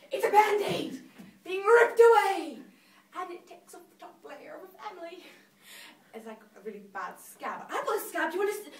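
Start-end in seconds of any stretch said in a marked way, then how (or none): 0.1-2.6 loud, raised-pitch exclamation; sudden high, loud hit like a plaster torn off; ripping outburst. Acted. Likens grief to ripped Band-Aid. 7.7-8.7 eager showing off. Acted. Acts out showing off a scab.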